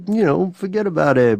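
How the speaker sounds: hammy Brooklyn tough-guy accent; mock Brooklyn accent. Shown as a demonstration. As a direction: demonstrate a hammed-up Brooklyn accent. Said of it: "Like a Brooklyn thing?".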